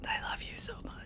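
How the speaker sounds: very soft, voiceless whisper; whispered adoration. Real, not acted. Streamer to game character mid-dialogue, adoring whisper.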